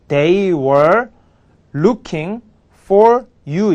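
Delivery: each word set apart, "They were looking for you" read clearly; teacher's slow model read of "They were looking for you"; slow model sentence. Shown as a demonstration. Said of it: "이렇게 되겠죠".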